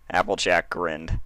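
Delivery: plain reading voice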